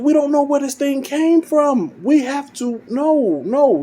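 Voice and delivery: high, strained pleading; mock panicked protest. Pa Kent arguing with his wife over the baby, panicked protest. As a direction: imitate a husband protesting, pitch way up.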